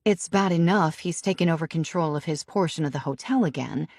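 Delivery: ordinary delivery, nothing marked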